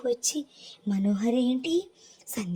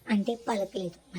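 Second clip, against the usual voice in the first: ordinary delivery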